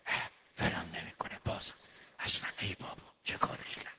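muttered under the breath; soft irritated private mutter; inner grumble. Retold or imitated. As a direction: whisper the husband's secret annoyance. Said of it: "تو خوش داره میگه".

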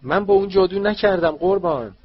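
high, loud house-elf cry of protest; a small elf crying out in panic; elf shriek. Acted. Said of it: "وینکی جیغ زد".

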